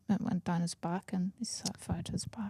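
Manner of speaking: hushed whisper. Real, not acted. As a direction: drop to a whisper. Lowered voice telling gory detail.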